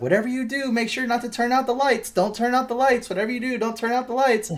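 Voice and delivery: very high, insistent warning voice; anxious animatronic babysitter. Retold or imitated. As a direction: keep pleading in a squeaky robot-nanny voice. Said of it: "all he keeps saying".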